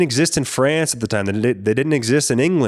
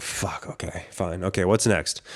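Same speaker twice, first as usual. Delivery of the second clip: whispered, much softer fuck okay; under the breath, giving in; resigned mutter; 6 semitones lower, 7 dB quieter than usual. Retold or imitated. Told to wait for the boss, muttering in resignation.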